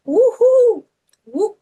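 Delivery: high loud whooping call; woohoo call. Retold or imitated. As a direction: whoop it like a rally cry. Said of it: "the other great philosopher".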